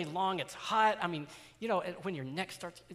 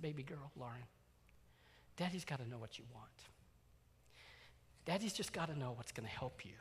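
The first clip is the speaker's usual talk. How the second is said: soft, gentle, much quieter; tender coaxing; 5 dB quieter than usual. Retold or imitated. Father kneeling to his crying daughter, soft and tender. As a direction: kneel down and soothe her gently. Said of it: "get down right on her level".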